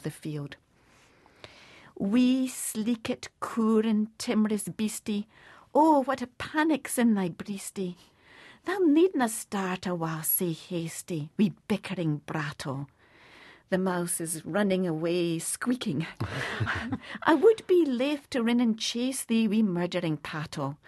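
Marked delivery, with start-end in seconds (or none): 2.0-12.9 tender, hushed recitation of the opening lines, whispered; Scots poem recitation. Acted. 17.2-20.8 louder, higher earnest recitation; Scots poem recitation. Acted.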